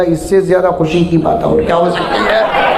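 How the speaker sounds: joking reply with a laugh in it; laughing retort. Retold or imitated. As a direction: toss out the punchline laughing. His joking retort to widower.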